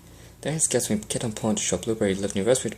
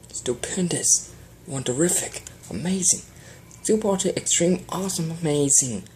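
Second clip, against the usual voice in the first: gushing string of praise, higher and higher; excited cry; 7 semitones higher than usual. A narrator's reading. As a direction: pile on the praise breathlessly. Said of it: "Prince cried".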